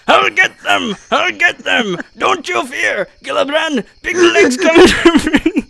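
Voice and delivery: high, loud dwarf war cry; dwarf rallying his friends. Acted. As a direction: shout like a gruff dwarf. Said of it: "good dwarf voice".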